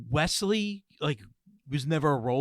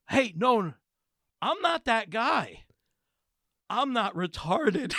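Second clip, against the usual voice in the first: higher, slow, deliberate correction; imitated dead-serious protest; 6 semitones higher, slower than usual. Quoting the stranger correcting him, dead serious. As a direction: quote him in earnest, slowly, with pauses between statements. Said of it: "dead, drop dead serious".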